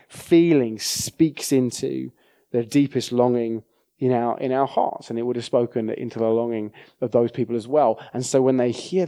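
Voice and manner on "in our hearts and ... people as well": ordinary talk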